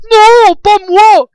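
crié très aigu et très fort, lent; cri effrayé. Acted. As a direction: refuse en criant, paniqué. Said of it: "s'écria Bunz en prenant la fuite". (Bunz s'écrie en fuyant, apeuré)

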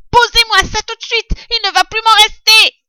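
very high, loud rat shriek; yelling rat. A narrator's reading. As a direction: shoo them off in a piercing rat voice. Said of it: "hurla le rat". (rat qui hurle, voix suraiguë)

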